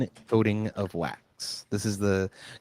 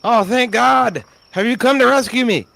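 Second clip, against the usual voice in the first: relieved captive; 13 semitones higher, 8 dB louder than usual. Acted. Captive farmer to his rescuers, high relieved cry. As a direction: gasp it out like a freed prisoner. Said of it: "he looks around and says".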